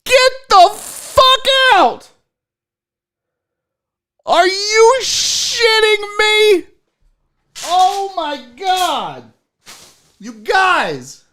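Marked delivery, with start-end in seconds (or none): 0.1-9.3 very high, loud, drawn-out disbelief; astonished shrieking. Real, not acted. Unboxer discovering the gift's surprise, astonished shrieking. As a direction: shriek it slowly in happy shock. Astonished by what the gift does.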